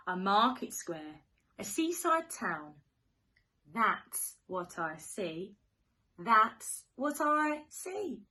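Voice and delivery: sing-song storybook rhyme; reading to children. Acted. Teacher reading to little ones, sing-song and playful. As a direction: read it like a bedtime picture book. Reads rhyming picture book.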